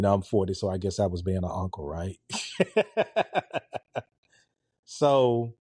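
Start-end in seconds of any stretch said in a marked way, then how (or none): 2.2-4.0 high staccato chuckle; a string of short, high-pitched giggles; laugh. Real, not acted. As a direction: laugh lightly in quick, even pulses. Laughs at own uncle remark.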